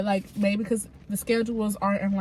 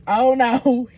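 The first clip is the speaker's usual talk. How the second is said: loud, higher "oh no" of dread; mock dismay; 3 semitones higher, 10 dB louder than usual. House manager reacting to the bubbly assistant arriving, mock dismay. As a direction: imitate someone dreading an arrival. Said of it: "he was like".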